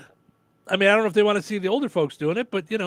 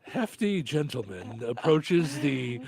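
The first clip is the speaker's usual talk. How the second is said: nature documentary narrator; 3 semitones lower, slower than usual. Pretend. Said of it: "Australian accent should be narrating".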